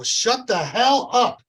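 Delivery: loud slow snap. Retold or imitated. Dad snapping at screaming son.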